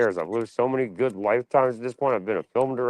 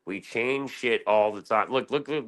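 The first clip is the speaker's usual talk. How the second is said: usual speaking voice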